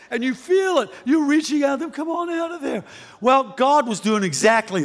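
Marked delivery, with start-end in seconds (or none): 1.9-2.8 high, soft call into a cave; coaxing call. Acted. Woman reaching out to withdrawn man, coaxing call. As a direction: gently call someone out from far back.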